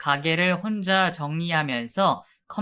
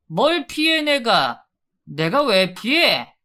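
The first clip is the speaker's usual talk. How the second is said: higher, louder defensive yell; angry shouting back; 5 semitones higher, 3 dB louder than usual. Acted. Husband yelling back at his wife, loud defensive anger.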